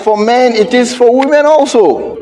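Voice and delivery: high, urgent preacher's cry; preaching. Acted. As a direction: preach it out with rising fire. Preacher at full force.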